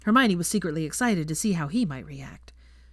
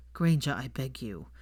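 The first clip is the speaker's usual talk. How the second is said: lower, slow, long-suffering plea; mock pleading Draco; 4 semitones lower, 4 dB quieter than usual. A narrator's reading. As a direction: beg her to stop, half joking.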